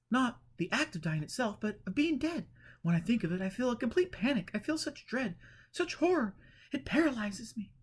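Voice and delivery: fearful Eleanor. A narrator's reading. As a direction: beg for help in dread.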